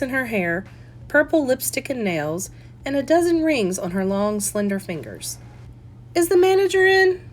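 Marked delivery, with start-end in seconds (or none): 6.1-7.2 higher, louder question into the shop; calling out. Acted. Customer calling into the shop, higher and louder. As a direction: call to the back.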